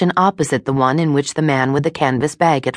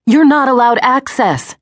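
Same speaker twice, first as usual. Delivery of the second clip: loud, high angry shout; 10 semitones higher, 5 dB louder than usual. A narrator's reading. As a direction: yell it after the fleeing man. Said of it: "she shouted at the fleeing man".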